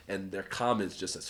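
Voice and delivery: ordinary delivery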